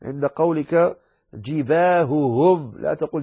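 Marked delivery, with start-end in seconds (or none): 1.3-2.7 جباههم slow, each ha separate; both ha sounds clear. Shown as a demonstration. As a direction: split the two ha in جباههم. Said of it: "وصف الهاء".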